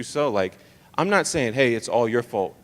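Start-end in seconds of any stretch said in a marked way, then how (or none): none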